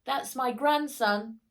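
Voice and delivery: a loud, high proud call; proud shout. A narrator's reading. Grandpa boasts to the circus audience, loud proud shout. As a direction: shout it over the cheering crowd. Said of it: "shouted Grandpa".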